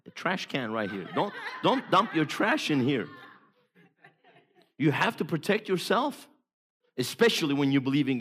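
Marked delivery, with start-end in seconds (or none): 0.0-3.1 high, half-laughing put-down; mock indignant protest. Telling negative people off, high and laughing. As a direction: push back on the rubbish dumper with a laugh.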